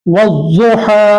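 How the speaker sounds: वज़ुहा slowed right down, व joined to a doubled ज़; slow recitation. Shown as a demonstration. Teacher slowly models वज़ुहा after explaining the rule. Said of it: "वाव को ज़ाद से मिलाकर पढ़ेंगे".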